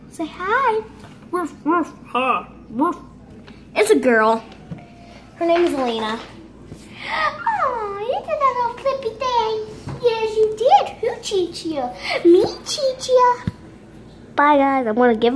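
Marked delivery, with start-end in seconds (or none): 1.3-3.0 small breathy woofs; imitated dog bark. Barking on behalf of the dog. 7.1-13.4 high cooing pet voice. Real, not acted. Cooing at the dog.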